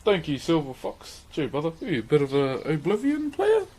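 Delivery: ordinary talk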